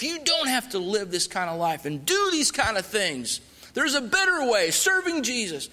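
builds from calm to high, urgent pleading; pressing the gospel on a captor, rising as he goes; earnest witnessing. Retold or imitated. Missionary witnessing to his armed captor, building urgency. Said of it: "I started witnessing to him".

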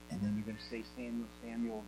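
very low, quiet aside; hushed prompting. Real, not acted. Teacher privately cueing the voice of the Lord, hushed. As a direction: cue the helper under your breath.

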